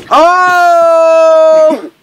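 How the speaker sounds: real loud startled cry. Real, not acted. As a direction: react to a chair toppling. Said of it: "we just freaked out".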